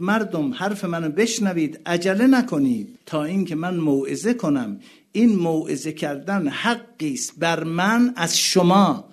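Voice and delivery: loud, high pleading sermon voice; impassioned preaching. Acted. Preacher voicing Husayn pleading with the army, loud. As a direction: plead with the crowd.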